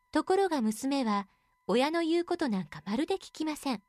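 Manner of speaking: plain reading voice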